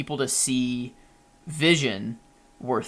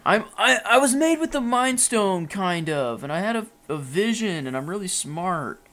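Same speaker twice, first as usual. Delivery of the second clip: put-on higher, boastful kid voice; mock Ultron bragging; 7 semitones higher than usual. Podcaster keeps mocking Ultron with a higher smug brag. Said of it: "continuing the Ultron mockery".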